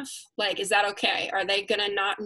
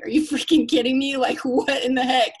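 husband's incredulous; 3 semitones higher, 5 dB louder than usual. Retold or imitated. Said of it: "He's like".

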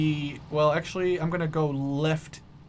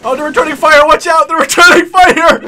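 alarmed shout; 11 semitones higher, 15 dB louder than usual. Real, not acted. Under fire in game.

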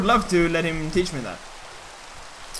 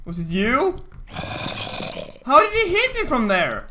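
raging gamer; 8 semitones higher, slower than usual. Real, not acted. Getting hit, raging.

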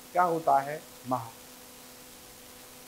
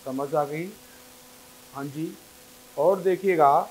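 usual speaking voice

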